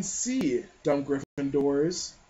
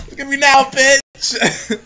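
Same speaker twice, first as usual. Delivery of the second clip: louder, higher mock gloat; gloating Harry; 6 semitones higher, 11 dB louder, slower than usual. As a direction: crow it at the crowd. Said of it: "He's like".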